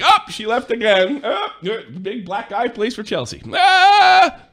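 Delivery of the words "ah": mock yelp. Mocking target hitting on a woman.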